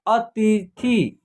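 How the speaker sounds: अतिथि very slowly, syllable by syllable; model pronunciation. Shown as a demonstration. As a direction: stretch अतिथि into three separate beats, a-ti-thi. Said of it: "क्या लिखा हुआ है". (अतिथि को धीरे-धीरे, अ-ति-थि तोड़कर बोलना)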